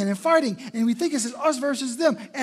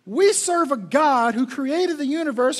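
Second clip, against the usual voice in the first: ordinary talk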